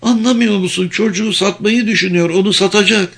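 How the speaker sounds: high, panicked urgency; high-pitched desperate warning; cracking with panic. A narrator's reading. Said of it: "Sesi çatladı ve gözlerinde panik".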